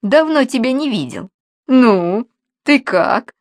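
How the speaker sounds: high, loud, mincing gossip; mincing, affected. Acted. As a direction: gush over him, all affected charm. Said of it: "жеманно произнёс Алексей".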